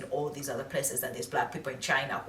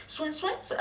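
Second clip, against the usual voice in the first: confused mock babble; 4 dB quieter than usual. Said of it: "And I'm like".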